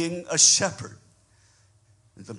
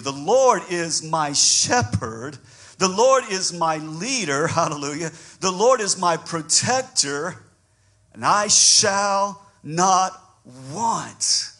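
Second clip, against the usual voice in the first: louder, higher and slower, building to a cry; preaching at full force; 5 semitones higher, 5 dB louder, slower than usual. Acted. Pastor preaching Psalm 23 to the congregation, full force. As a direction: preach it out with mounting fire.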